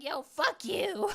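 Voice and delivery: a hint of her body mouthing off at her; voicing her body. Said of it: "It's just like".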